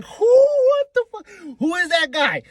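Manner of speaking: high, loud, stretched "what the fuck"; baffled fan seeing a silhouette; shocked confusion. Retold or imitated. Fan reacting to a silhouette, shocked and baffled.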